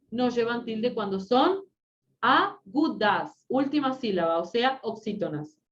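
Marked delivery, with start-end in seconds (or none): 2.2-3.4 "agudas" slowly split into A, GU, DAS; syllable by syllable. Shown as a demonstration. Teacher naming the word class for students, syllable by syllable. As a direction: separate each syllable of "agudas".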